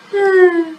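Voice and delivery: short high-pitched chuckle; amused little laugh at a friend's rant. Acted. Character laughs at Romano's rant.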